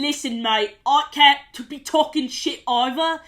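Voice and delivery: lightly put-on British accent, self-mocking. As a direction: slip into a jokey British accent on mate. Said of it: "I, I can't do an accent".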